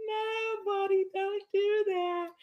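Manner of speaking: high, slow, pleading sing-song; too-sweet owner begging the dog; weak sing-song no. Shown as a demonstration. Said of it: "It's no, firmly".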